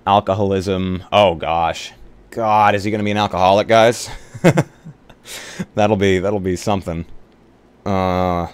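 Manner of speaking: normal talking voice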